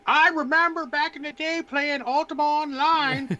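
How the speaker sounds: put-on high, slow old-timer voice; very old man. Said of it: "You gotta put your old man voice on".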